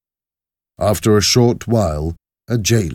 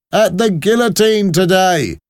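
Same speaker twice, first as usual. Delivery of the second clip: high, loud repeated demand; raised, insistent soldier's voice; 14 semitones higher, 5 dB louder than usual. Acted. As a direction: repeat it forcefully as an impatient soldier. Soldier presses his question.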